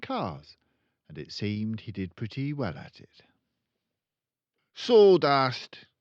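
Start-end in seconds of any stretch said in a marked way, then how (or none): 4.7-5.9 loud, high, boastful Mr Wormwood; proud father. Acted. Car dealer father boasting to his daughter, loudly proud. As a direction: crow it like a braggart car dealer. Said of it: "he would say proudly".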